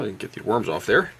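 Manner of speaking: ordinary talk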